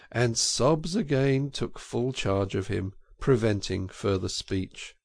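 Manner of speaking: ordinary delivery, nothing marked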